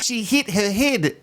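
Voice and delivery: teasing Kiwi accent imitation; mock Kiwi accent. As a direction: imitate a Kiwi, drawing the words out. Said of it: "the Kiwi accent is just the best".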